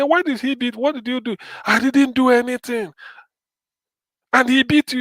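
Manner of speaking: put-on high, probing questions to a crying child; lightly voiced concerned uncle; questioning adult.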